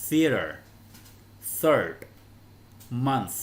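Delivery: Theater, third, month, each apart with a voiceless th; loud, isolated words modelling the th; teacher models the th in Theater, third, month; voiceless th demo. Shown as a demonstration. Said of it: "ba từ này đều đọc bằng âm th".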